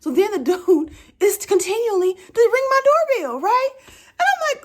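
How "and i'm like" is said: squealing with laughter much higher; laugh-cry at the absurdity. Real, not acted. Laughing at her own plight.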